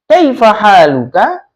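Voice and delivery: "Kaifa haluka" with the pitch climbing on the end; questioning rise. Shown as a demonstration. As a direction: raise the tone on the last syllable of "Kaifa haluka". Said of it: "Nadanya itu naik". (nada naik di ujung "Kaifa haluka")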